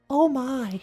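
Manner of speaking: put-on high, prim old woman voice; imitating a fussy elderly mum; old lady gasp. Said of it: "and she goes".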